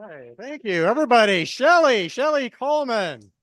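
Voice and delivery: loud, high announcement over applause; big emcee shout-out; rousing host call-out. Real, not acted. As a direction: belt out the comic's name to the crowd. Host calls applause for departing comic.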